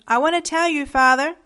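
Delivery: put-on high, loud plea of an upset boy; acted boyish outburst, pitch held up; distressed confession. A narrator's reading. Sobbing boy tries to confess.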